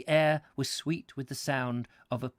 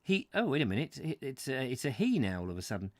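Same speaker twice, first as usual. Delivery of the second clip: plain reading voice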